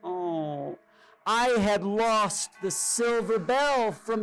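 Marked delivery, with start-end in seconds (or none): none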